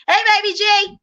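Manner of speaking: high, loud sing-song greeting; friendly greeting impression. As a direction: greet her like a bubbly pal. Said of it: "just a normal".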